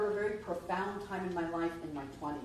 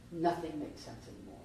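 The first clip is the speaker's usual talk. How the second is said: ordinary talk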